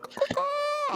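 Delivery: loud high bird caws; cawing like a crow. Pretend. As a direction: caw like a rooster crowing. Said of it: "like, with the echo and everything".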